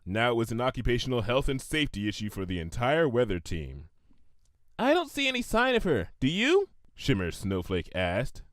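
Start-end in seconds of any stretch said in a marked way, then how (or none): 4.8-6.7 high eager pony voice. Acted. Shimmer Snowflake character voice.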